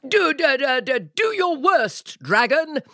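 high stuttering frightened knight; stammering fear. A narrator's reading. Knight stammers at the dragon in high nervous fear. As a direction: stutter it out, scared.

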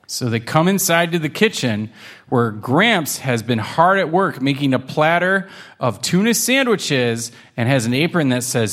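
usual speaking voice

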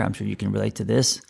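ordinary delivery

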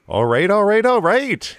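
put-on high-pitched, sing-out Minnesota accent. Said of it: "in a Minnesota accent".

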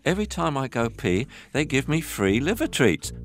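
high, gushing puppy voice; excited puppy voice. Retold or imitated. Puppy telling the Akita about the liver treats, delighted. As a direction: rave like a delighted little puppy. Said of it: "go down the garden to Akita and say".

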